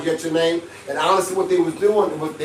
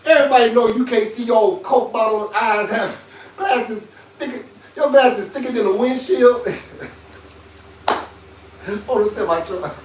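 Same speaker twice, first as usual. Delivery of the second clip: higher-pitched ribbing through laughter; laughing teasing; 4 semitones higher than usual. Retold or imitated. Ribbing a friend about glasses, laughing. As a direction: roast a friend while cracking up.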